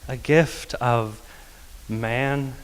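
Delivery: ordinary delivery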